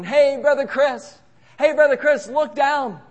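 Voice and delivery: high, loud mock holler; calling up. Fellow ministry workers down on the ground, hollering up. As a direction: imitate a crowd shouting up to someone far above.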